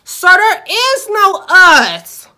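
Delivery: slow, loud, high incredulous cry; shocked disbelief. Real, not acted. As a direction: recoil at the very idea of us. Shocked at customer's proposition.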